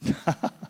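a light airy laugh; imitated laugh. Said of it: "she started laughing".

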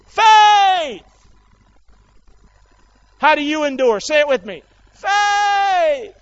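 high, loud shouted call-and-response; rallying preaching. Real, not acted. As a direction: shout it so the congregation joins in. Rallying the congregation.

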